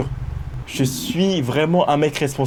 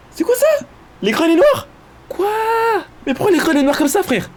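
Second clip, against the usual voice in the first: sudden high-pitched alarm. Real, not acted.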